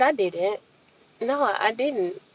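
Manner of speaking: gentle quiet reply, held back; softly as I could. Retold or imitated. Refusing a handshake, she answers the friend softly. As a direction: say it as softly as you can. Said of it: "as nice as I could".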